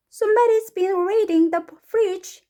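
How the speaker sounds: high, loud, slow character voice; slow, high complaint; indignant story mother. Acted. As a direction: read as an astonished storybook mum. Mrs. Blair voiced in story.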